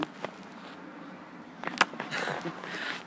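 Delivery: breathy laugh. Real, not acted. Laughs at own crazy look.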